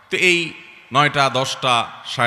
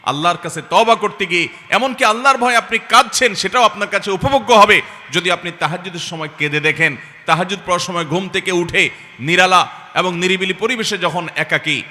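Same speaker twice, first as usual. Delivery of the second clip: high, loud, fervent sermon; impassioned preaching; 6 semitones higher, 7 dB louder than usual. Acted. Preacher urging tearful night prayer, impassioned preaching. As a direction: pour out the exhortation at full force. Preacher at full force on repentance.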